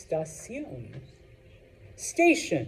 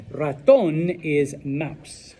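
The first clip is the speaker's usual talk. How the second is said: slow and clear; 3 semitones lower, much slower than usual. Shown as a demonstration. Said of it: "teacher models the word".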